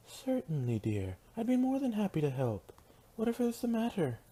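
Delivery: high, lilting lady's voice; solicitous and high-pitched; gracious Rarity, eager to help. Acted. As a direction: offer help to a dear friend, pitched high.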